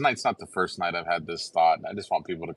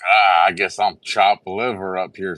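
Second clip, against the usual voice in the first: louder, slow playful complaint; mock hurt; 2 semitones higher, 8 dB louder than usual. Guest teasing the host for skipping him, mock hurt. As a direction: play at being forgotten and offended.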